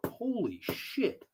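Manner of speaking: slow, hushed disbelief; stunned holy shit at a huge bug. Real, not acted. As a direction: breathe it out in shock. Startled by giant bug.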